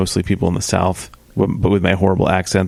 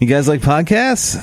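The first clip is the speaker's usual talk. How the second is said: lightly voiced breezy question, higher; casual Zoom ask; 6 semitones higher than usual. Co-host mimics a listener pitching in a Zoom meeting, breezy. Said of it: "just be like".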